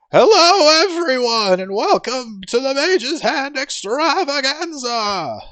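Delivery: booming, high showman voice; magically amplified voice. Acted. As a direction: boom it out like a spell-amplified host. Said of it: "speaks with a booming voice".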